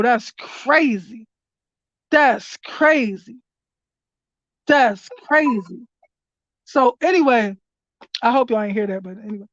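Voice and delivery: ordinary talk, nothing marked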